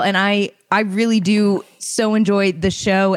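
normal talking voice

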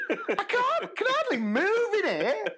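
high, laughing exasperation; laughing complaint. Retold or imitated. Teacher to cleaner, laughing exasperated protest.

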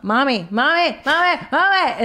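put-on high, insistent child calling Mommy; pestering kids. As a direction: imitate kids ramping up, pitch climbing on each call. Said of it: "when they really ramp up".

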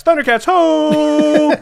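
high, loud, drawn-out Thundercats battle cry. Pretend. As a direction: belt it like a cartoon hero rallying.

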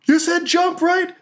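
high, gleeful battle yell. Acted. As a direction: yell it high as she leaps. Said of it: "And she yells".

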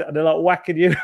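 normal talking voice

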